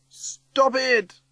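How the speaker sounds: high-pitched yelled command. A narrator's reading. Teggy pointing at Lucy in the flood, yelling. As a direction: yell to halt it. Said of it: "Teggy yells".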